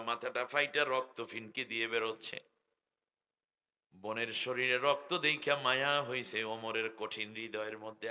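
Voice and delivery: ordinary talk, nothing marked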